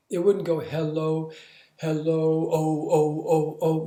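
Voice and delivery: hello then oh, oh, oh, oh bounced off in separate pulses; wrong choppy hello. Shown as a demonstration. Demoing what a frozen long sound would not do. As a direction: chop the O into pulses. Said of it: "No, no, no".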